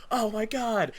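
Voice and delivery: high, amazed gasp of words; awed kid. Retold or imitated. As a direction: marvel like a little kid. Kid self amazed at toy.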